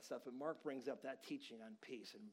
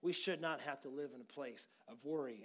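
ordinary talk, nothing marked

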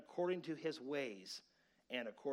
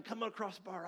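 retold threat; 7 semitones higher than usual.

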